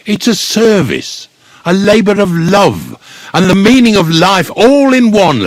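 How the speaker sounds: extol your trade with swelling pride; high-pitched, passionate declaration; impassioned. Acted. Gravedigger extolling his trade.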